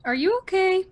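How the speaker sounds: startled concern for a friend; student asking her crying friend; concerned surprise. Acted. Student notices friend sniffling.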